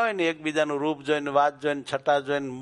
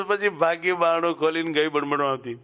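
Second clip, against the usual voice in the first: ordinary delivery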